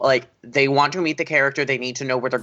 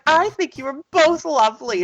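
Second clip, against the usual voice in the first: much higher, lilting princess voice; Cinderella; 13 semitones higher than usual. Retold or imitated. Princess answering the evil queen's question, high diplomatic voice. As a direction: pitch it way up as a mock princess. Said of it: "Cinderella's like".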